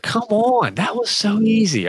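excited. Real, not acted. Groaning at his own joke.

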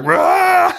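a high, loud, hammed-up monkey shriek; mock monkey roar. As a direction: screech like a crazed monkey. Monkey loses its mind.

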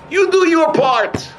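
high, slow, stretched-out exhortation; emphatic exhortation. Real, not acted. Preacher to listeners after the story, emphatic exhortation. Preacher drives home the moral.

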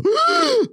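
sharp high gasp; a high-pitched startled intake of breath; imitated gasp. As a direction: mimic her startled gasp. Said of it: "then she'll go".

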